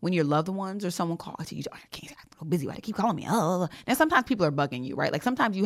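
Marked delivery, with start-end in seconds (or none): 1.7-3.7 hushed grumble ending in a groan; mock exasperation. Too busy for loved ones calling, mock exasperation. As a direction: play the too-busy worker fed up with calls.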